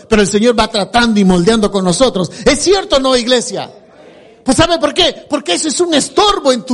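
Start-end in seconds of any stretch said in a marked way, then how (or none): none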